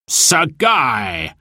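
calling out across the field; cried out. Acted. Sheriff calls out to the cloaked rider, a ringing shout. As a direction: call out to a rider arriving. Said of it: "cried the sheriff".

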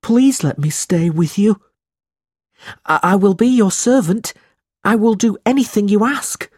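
put-on a desperate runaway boy clinging to his only friend; runaway boy begging to stay, high and pleading; pleading boy Smike. Narrator voices Smike pleading.

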